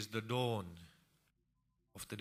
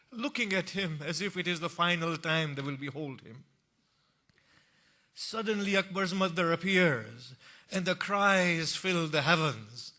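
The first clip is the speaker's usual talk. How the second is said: higher, louder mourning recitation; lamenting sermon; 6 semitones higher, 9 dB louder than usual. Acted. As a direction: let the grief fill the hall.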